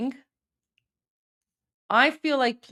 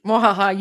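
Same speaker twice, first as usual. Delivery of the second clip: mwahaha cartoon villain cackle; evil laugh; 3 semitones lower, 4 dB louder than usual. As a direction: cackle like a villain taking over. Evil laugh after host leaves.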